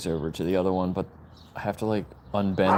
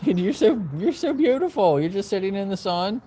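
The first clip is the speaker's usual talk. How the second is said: much higher sweet talk to a pet; 12 semitones higher than usual. Real, not acted. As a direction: coo at the dog in a high voice. Baby talk to dog.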